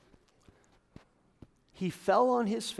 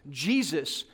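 ordinary delivery

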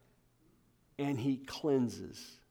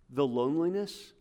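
ordinary talk, nothing marked

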